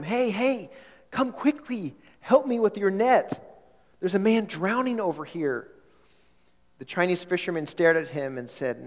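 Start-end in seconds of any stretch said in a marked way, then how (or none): 0.0-5.7 higher, urgent shout for help across the water. Acted. Missionary shouting to fishermen for help, urgent higher call. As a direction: yell to the far-off fishermen to come quickly. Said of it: "he shouted out to them".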